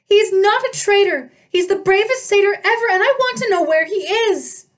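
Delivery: high, loud furious dryad; angry nymph. A narrator's reading. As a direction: defend him fiercely, stamping. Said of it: "Juniper stamped her foot".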